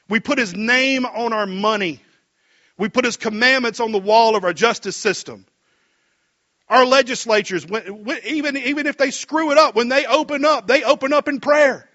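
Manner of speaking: rising, fervent pulpit delivery, high and loud; preaching at full force. Acted. Pastor stirring the congregation about America's faith, preaching hard. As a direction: build the fire like a revival preacher. Preaching the nation's godly founding.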